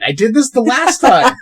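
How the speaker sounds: loud, high self-reproach; comic real frustration; exasperated. Real, not acted. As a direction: wail at your own slip. Exasperated at own repeated slip.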